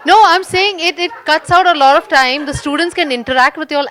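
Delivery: emphatic. Real, not acted. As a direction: press the point hard and loud. Insisting on her point in discussion.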